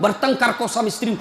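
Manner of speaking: normal talking voice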